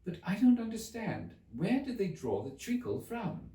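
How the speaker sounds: high, careful question; cautiously. Acted. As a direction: ask carefully, not to offend. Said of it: "she began very cautiously".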